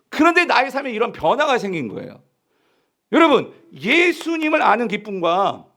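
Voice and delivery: sustained high-pitched, forceful sermon delivery; impassioned preaching. Real, not acted. Preacher telling the congregation of the servants' greater joy, impassioned. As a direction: build the point with ringing conviction.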